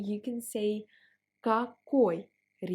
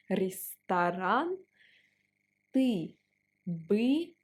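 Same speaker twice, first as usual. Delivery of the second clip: ordinary delivery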